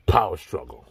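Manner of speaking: usual speaking voice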